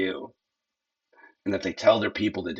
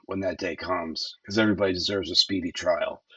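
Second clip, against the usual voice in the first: ordinary delivery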